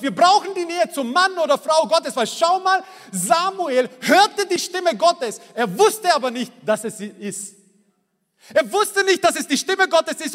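high, driving sermon delivery; fervent preaching. Acted. Pastor urging the congregation toward closeness with God, fervent preaching. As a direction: preach it with rising fervour. Preacher building to full force.

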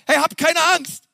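high, bright call with "keine" leaned on; Moses reassuring. Acted. Preacher replaying Moses to the crowd, pitched high. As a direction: cry out "habt keine Angst" to the crowd. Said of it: "der Hammer, oder?".

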